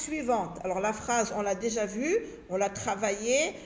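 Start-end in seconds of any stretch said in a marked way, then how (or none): none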